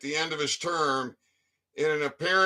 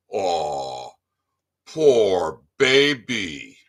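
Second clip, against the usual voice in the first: mock pity; 9 semitones lower, 3 dB louder, much slower than usual. Real, not acted. Mocking the governor's husband with fake baby-talk pity.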